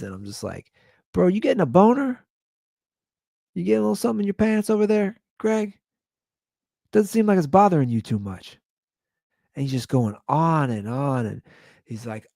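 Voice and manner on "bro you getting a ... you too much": very high, sing-song needling; playfully pry in a squeaky high voice; mock teasing. Retells teasing his neighbor.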